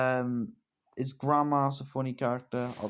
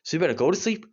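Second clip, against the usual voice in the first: mock angry threat; 5 dB louder than usual. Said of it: "And the guy says".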